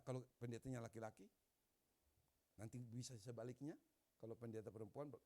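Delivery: ordinary talk, nothing marked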